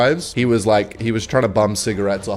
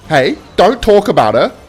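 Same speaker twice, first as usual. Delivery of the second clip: sharp, louder, higher snap at me; angry drunk; 8 semitones higher, 8 dB louder than usual. Retold or imitated. Drunk man snapping at me not to mention her, higher and louder. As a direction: bark a warning at the listener. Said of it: "he's like".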